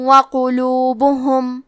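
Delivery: جمیعاً into وقلوبہم slowly, nasalized doubled واو; nasal merge. Shown as a demonstration. Teacher demonstrating idgham to students, slow. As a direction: merge the tanween into وقلوبہم with ghunna. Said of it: "غنہ اور تشدید کے ساتھ ادا کریں گے".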